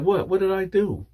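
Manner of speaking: high-pitched imitation of innocent protest; feigned innocence. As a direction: play innocent when accused. She feigns innocence when accused.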